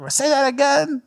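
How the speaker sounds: high-pitched mock confusion; puzzled restaurant worker. Pranked staff to the caller, mock bafflement. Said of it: "and then they would be like".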